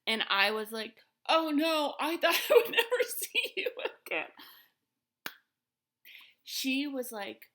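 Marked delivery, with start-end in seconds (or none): 1.3-4.3 embarrassed laughing exclamation. Retold or imitated. Bumping into the same lady again.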